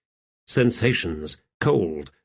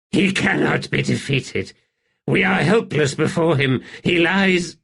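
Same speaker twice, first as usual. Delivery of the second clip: haunted emperor; 6 semitones higher than usual. Acted. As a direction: build from dread to a cry. Rulad voiced, rising panic.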